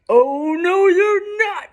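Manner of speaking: loud, high roaring shout; troll's roar. Acted. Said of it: "roared the troll".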